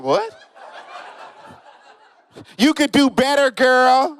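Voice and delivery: high, sassy woman voice; woman onlooker telling the girlfriend to leave him, high and sassy. Retold or imitated.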